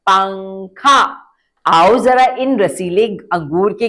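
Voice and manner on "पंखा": पंखा stretched slowly, clear nasal ng; slow repeat demo. Shown as a demonstration. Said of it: "फिर से बोलिए".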